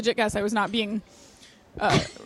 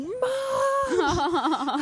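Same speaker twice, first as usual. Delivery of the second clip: high yelled call for mom; high mock yell of Mom; 9 semitones higher than usual. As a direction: shout for your mother in a panic. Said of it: "I'm like".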